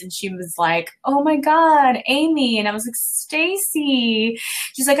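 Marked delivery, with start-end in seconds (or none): 1.1-2.6 high, loud squeal of recognition; excited greeting. Retold or imitated. Tipsy woman spotting a friend in line, excited. 3.0-4.4 high, drawn-out name call; mock-thrilled hail to a friend; excited greeting. Answering a friend spotted at the stage door, excited. Acts out own reply to friend.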